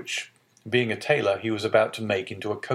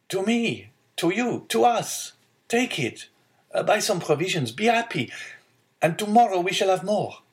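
put-on high, joyful young man; excited; 10 semitones higher than usual. A narrator's reading. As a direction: eagerly share the good news. Joyful son gives money.

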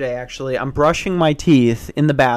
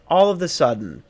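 ordinary talk, nothing marked

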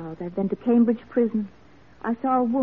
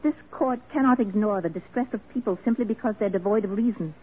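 ordinary talk, nothing marked

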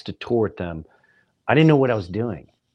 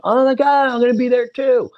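much higher and louder, gushing; fake excitement; 12 semitones higher, 7 dB louder than usual. Comic telling the drummer he'll be in town, overexcited. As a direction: gush like it's a happy coincidence. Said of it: "I went".

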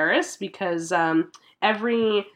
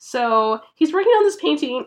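ordinary delivery

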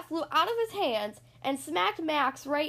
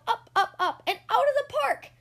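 excited; 9 semitones higher, 4 dB louder than usual. A narrator's reading. Winning home run.

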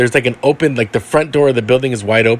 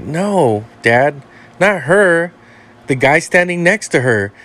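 put-on higher, slow and deliberate child voice; a small girl pointing out the ghost; little girl insisting; 5 semitones higher, slower than usual. Little girl tells her dad which one she means, higher, slow and insistent.

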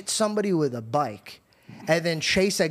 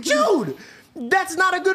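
high, incredulous outcry; retold protest, pitched way up; exasperated protest; 11 semitones higher than usual. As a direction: cry out in disbelief at a silly excuse. Acted protest at dad's excuse.

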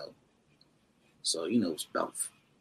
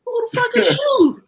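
higher and louder incredulous shout; mock outraged shout; 10 dB louder than usual. A comic retelling the headliner's shouted brush-off. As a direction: bellow it like an offended star. Collier barks at unrecognized comedian.

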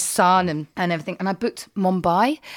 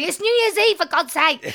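exasperated; 9 semitones higher than usual. Retold or imitated. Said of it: "and I was going".

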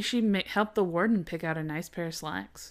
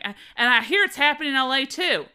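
higher, louder Southern belle; 6 semitones higher, 5 dB louder than usual. Retold or imitated. Host retelling talk show chatter, Southern belle accent. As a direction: keep up the mock Southern belle. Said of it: "making her a Southern Belle".

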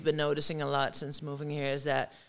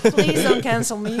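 loud, higher, through laughter; laughing plea; 4 semitones higher, 10 dB louder than usual. Real, not acted. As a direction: plead while cracking up.

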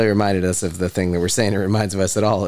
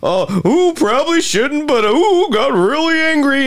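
very high, mock-flustered voice; mock the suspect's rush of nerves in a high squeal; mocking imitation. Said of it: "Bet you're all adrenaline now".

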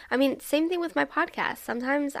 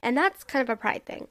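usual speaking voice

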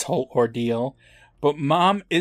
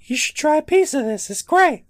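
high mock voice; 14 semitones higher, 4 dB louder than usual.

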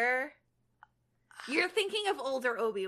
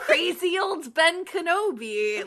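slow, louder, higher mock old Ben; old hermit voice; 7 semitones higher, 8 dB louder, slower than usual. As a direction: drawl it like a crazy old hermit. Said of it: "Me trying to get the feel".

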